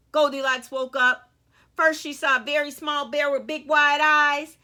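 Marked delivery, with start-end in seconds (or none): none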